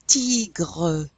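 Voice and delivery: "Tigre" as TEE-gruh with a throaty R; French "Tigre", final uh sounded; French E heard. Shown as a demonstration. Teacher answers own question, French "Tigre". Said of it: "you're gonna hear the E".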